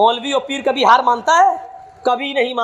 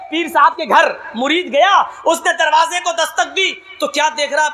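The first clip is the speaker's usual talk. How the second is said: ordinary talk, nothing marked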